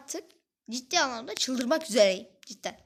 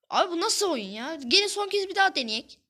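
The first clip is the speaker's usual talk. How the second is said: high, raised-voice complaint; exasperated outburst; 6 semitones higher, 5 dB louder than usual. Real, not acted. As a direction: protest loudly at a maddening game. Frustrated at the game, retrying. (sinirli, tiz ve yüksek sesle)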